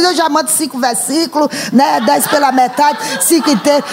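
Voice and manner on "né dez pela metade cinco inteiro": ordinary talk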